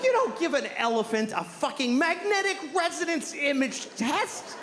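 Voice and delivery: ordinary delivery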